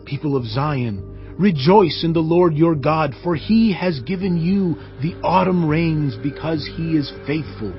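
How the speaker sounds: ordinary talk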